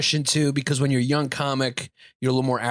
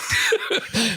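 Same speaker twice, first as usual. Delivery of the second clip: very high burst of laughter; squealing laugh. Real, not acted. Laughing at the joke.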